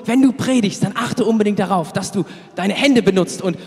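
a hint of an eager dad coaching his son; fatherly advice. Father on the phone coaching his preaching son, mildly voiced eager advice. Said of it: "und hat mir gesagt".

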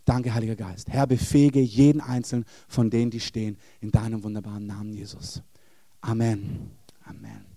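fading closing prayer. Acted. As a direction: let the prayer die away gently.

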